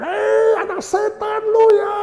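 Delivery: put-on high furious shriek at the child; mock parent shrieking insults, high and loud; screaming parent. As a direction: yell at your kid like a raging parent. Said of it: "Bukan teriak-teriak".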